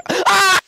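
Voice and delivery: a very high, loud pained shriek; high scream. Real, not acted. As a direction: shriek in distress. Scream while crying.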